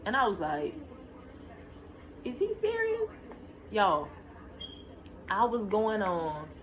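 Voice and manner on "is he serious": high incredulous question; can't believe the delay; exasperated disbelief. Retold or imitated. Exasperated at long labor.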